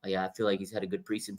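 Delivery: quick, soft, sarcastic mock of the coach. Head coach faking praise for the kicker, soft sarcastic mumble. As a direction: rattle it off softly, not meaning a word. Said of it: "he's capping on that when he says".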